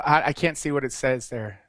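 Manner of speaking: ordinary talk, nothing marked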